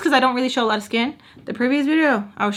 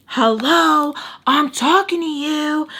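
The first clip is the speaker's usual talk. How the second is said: mock upset, higher and louder; imitating upset; 6 semitones higher, 4 dB louder, slower than usual. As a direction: act out someone demanding attention, voice raised. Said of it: "he's getting upset".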